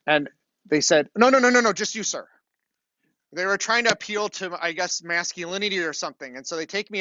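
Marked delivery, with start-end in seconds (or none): 1.2-2.3 clerk's quick no's, higher and louder at the start; lightly voiced clerk, brushing him off; hint of a pushy clerk. Rental clerk turns away the wife, mildly pushy imitation.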